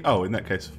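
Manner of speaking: ordinary delivery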